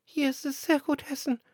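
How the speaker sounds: high chirpy bird voice; sparrows. Acted. Sparrows enjoy the doves' grain, high chirpy voice. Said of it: "sagten die Sperlinge".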